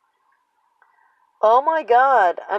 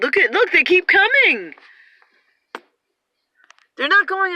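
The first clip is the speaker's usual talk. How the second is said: alarmed exclaiming; 8 semitones higher, 6 dB louder than usual. Real, not acted. Birds keep coming, alarmed.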